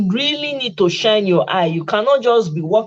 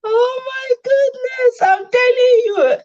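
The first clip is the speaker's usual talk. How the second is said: gushing squeal; slower than usual. Pretend.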